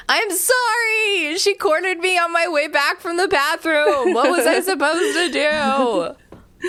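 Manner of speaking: very high, slow, pleading whine; whiny sister voice. Acted. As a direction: whine the excuse, half laughing. Voicing the sister, laughing.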